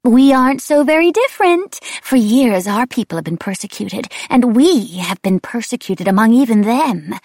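high, loud, unhinged singsong; demented wraith. A narrator's reading. Wraith insists on kinship in persecution, loud and crazed. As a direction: ring it out high and loud with eerie swoops. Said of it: "and repeated".

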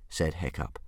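usual speaking voice

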